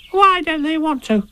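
tiny, very high squeaky youngster; high-pitched eager little voice; Roo's voice. Acted. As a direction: squeak the question like a curious little one. Roo asks Tigger a question.

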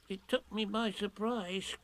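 soft, high, timid squeak; a small frightened pig; nervous. A narrator's reading. Piglet suggests Tigger, nervous and soft. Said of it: "said Piglet nervously".

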